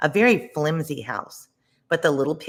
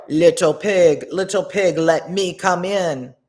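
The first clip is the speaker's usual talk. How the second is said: louder, coaxing wolf call, slow at the end; big bad wolf; 4 semitones higher, 8 dB louder, slower than usual. A narrator's reading. Wolf calls to the pig in the stick house, loud and coaxing. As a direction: call through the door like a hungry wolf. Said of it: "the wolf said".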